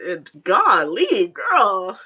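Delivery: real tearful outburst; teary exclamation. Real, not acted. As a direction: blurt it out through tears. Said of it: "you're boohoo crying on here".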